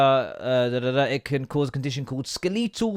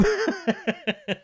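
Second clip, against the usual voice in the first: real laugh; 3 dB quieter than usual. Real, not acted. Laughs after swearing.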